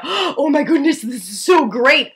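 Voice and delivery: high, loud burst of gushing delight; mock excitement. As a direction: act thrilled by a sudden brilliant idea. Acts out having a great idea.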